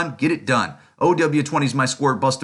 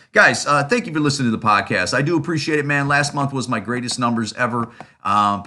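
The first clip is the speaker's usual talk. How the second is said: ordinary delivery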